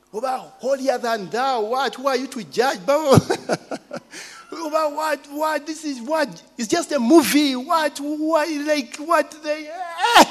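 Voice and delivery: high, indignant mock protest; hammed-up scoffing friends. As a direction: mimic the scoffers' outrage. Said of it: "began those things of grace".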